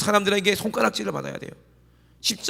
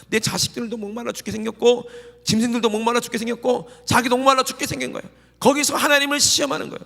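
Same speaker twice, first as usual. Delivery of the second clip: rising fervor; 7 semitones higher, 4 dB louder than usual. Acted. Preacher building on thirsty Israelites.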